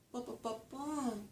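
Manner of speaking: soft, high "ba" syllables tracing a tune; sing-song nonsense syllables with a gliss; hummed speech melody. Shown as a demonstration. Lecturer imitating a sentence's melody, soft syllables. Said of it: "That little gliss".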